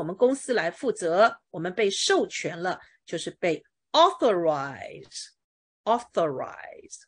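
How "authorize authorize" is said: "authorize" slow and drawn out, AU stressed; stress on first syllable. Shown as a demonstration. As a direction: spell out "authorize" by ear, leaning long on AU. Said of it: "动词的重音是第一音节". (“authorize”拉长慢读，重音在第一音节 AU)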